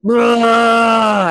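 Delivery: loud high mock "blah" of disgust; mock blurt. Telling friends about the bad trip, loud mock blurt. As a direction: spit it out like a burst of nausea. Describing grinding insectoid trip.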